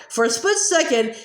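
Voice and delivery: ordinary delivery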